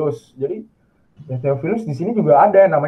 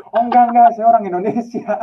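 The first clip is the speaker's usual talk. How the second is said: higher-pitched, louder mock protest; emphatic retold denial; 7 semitones higher, 4 dB louder than usual. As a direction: deny it quickly and emphatically, acting out the exchange. Acted denial in retold chat. (bantahan tegas yang ditirukan, bernada tinggi)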